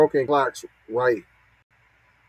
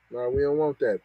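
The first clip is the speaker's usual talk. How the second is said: ordinary delivery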